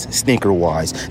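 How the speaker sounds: ordinary talk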